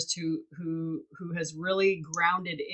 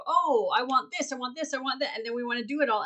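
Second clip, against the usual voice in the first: high, whiny grabby voice; mock greedy wanting; 6 semitones higher, faster than usual. Mimicking people who want everything, whiny and high. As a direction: play a kid in a candy store.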